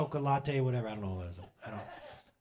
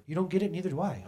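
ordinary talk, nothing marked

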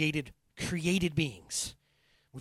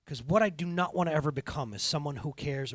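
ordinary talk

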